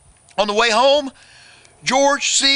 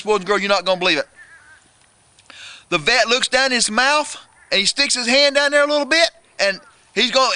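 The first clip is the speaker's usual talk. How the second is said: usual speaking voice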